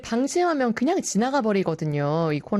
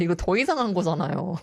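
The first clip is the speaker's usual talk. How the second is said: usual speaking voice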